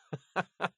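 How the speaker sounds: soft, high chuckle; amused laugh. Acted. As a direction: chuckle softly after a joke. Laughs after his pirate bit.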